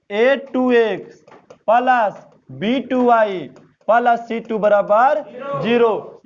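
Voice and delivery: spelling out the equation term by term, slowly; slow dictation. Shown as a demonstration. Teacher dictating the formula to students, slowly. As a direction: read it out slow so students can copy.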